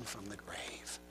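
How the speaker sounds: very soft, low whisper. Real, not acted. Preacher telling of the resurrection, soft whisper. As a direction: let it fall to a breath.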